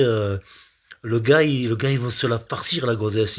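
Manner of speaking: ordinary talk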